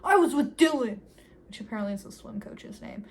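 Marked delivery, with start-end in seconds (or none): 0.0-1.0 loud, high retold outburst; dramatized angry admission; shouted confession. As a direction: blurt it out as an acted shout. Acted confession bursting out in quarrel.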